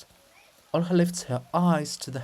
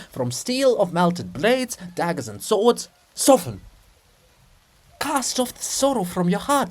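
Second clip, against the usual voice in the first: louder, fervent preaching; exhorting monk; 7 semitones higher, 6 dB louder than usual. Acted. Methodius fervently urging the princess to blaze up. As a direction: rouse her spirit like a prophet.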